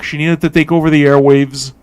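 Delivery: ordinary talk, nothing marked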